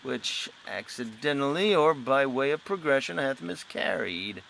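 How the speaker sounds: usual speaking voice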